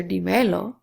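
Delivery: "dímelo" said di-ME-lo, stress on the middle; stress on next-to-last syllable. Shown as a demonstration. Teacher shows why the verb gets lost, "dímelo" stressed on ME. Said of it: "you don't recognize the verb".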